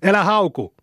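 high, indignant exclamation; mild shout. A narrator's reading. Saku tells the rival boys off, shouting mildly. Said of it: "huusi Saku".

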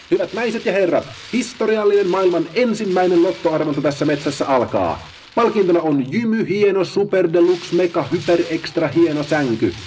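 high, loud, grand announcer voice; host the big draw like a TV lottery show; game-show host. Acted. Paula opens the draw for the sloths, grandly.

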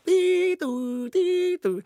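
high sung siren wail; playful siren noise; siren imitation. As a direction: mimic an ambulance siren going tiitu.

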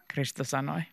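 ordinary delivery, nothing marked

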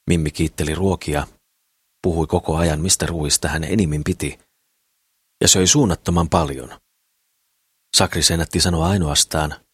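usual speaking voice

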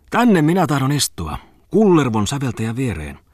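high, eager announcement; excited exclamation. A narrator's reading. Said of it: "puristeli nyt uudestaan Falkin kättä ja huudahti". (innostunut huudahdus)

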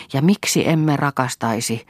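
usual speaking voice

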